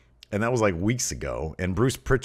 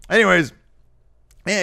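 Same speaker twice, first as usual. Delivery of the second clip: ordinary delivery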